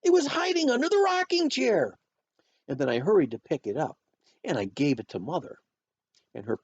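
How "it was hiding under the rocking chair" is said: put-on high, loud kid shouting a discovery; thrilled child's shout; excited shout. A narrator's reading. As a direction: shout it out, pitched way up. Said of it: "I shouted".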